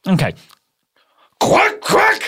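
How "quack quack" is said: high, put-on quack; Hitler duck quack.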